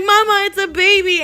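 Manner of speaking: high, loud call to mama; re-enacted shout for mom; retold panicked cry. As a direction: call out in alarmed disbelief.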